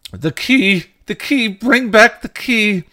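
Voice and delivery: very high, loud, frantic shout; deranged cry, stretched at the end. A narrator's reading. Knight cries as the door bangs shut, frantic. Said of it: "They heard the knight's cry".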